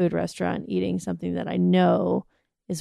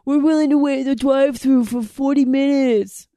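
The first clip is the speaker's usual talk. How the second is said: mock a brash East Coast local; East Coast accent; 4 semitones higher, 5 dB louder than usual. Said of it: "East Coast assholes being like".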